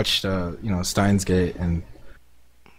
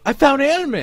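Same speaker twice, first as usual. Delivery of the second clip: high-pitched mock squeal of joy; excited squeal. Club guy announcing his find, high excited squeal. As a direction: squeal it like a thrilled fanboy. Guy freaking out beside him.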